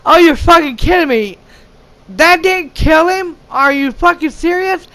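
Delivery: high-pitched disbelief; incredulous outburst. Retold or imitated. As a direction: react as if you can't believe it. Retelling own disbelief at the film.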